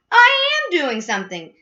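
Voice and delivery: high, loud protest; exasperated retort. A narrator's reading. Mister Gloop answers his screaming wife, exasperated.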